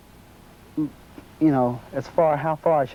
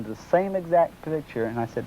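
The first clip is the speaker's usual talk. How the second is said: ordinary talk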